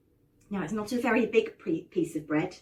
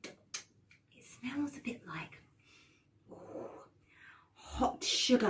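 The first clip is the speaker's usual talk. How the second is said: breathy, slow, hushed wonder; whisper; 11 dB quieter, much slower than usual. A narrator's reading. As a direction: savour the smell in a whisper. Whisper heard, smelling sweets.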